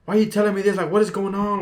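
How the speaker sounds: a hint of an angry stepdad; imitating angry stepdad. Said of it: "He's like".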